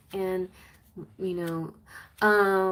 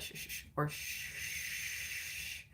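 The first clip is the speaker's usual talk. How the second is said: slow, soft repeated unvoiced shushes; slow, much softer rhythmic shh sounds; soothing baby shushing; 3 semitones lower, 11 dB quieter, much slower than usual. Shown as a demonstration. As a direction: hush a baby to sleep, slowly. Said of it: "you could do that".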